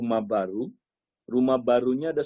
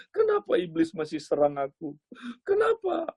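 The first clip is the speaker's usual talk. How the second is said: trembling complaint. Acted. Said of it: "Wah, dia mulai gemeter".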